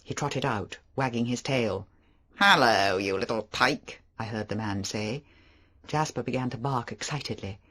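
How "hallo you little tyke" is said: louder hearty man greeting a dog; a big hearty visitor, booming hello; Favell. Acted.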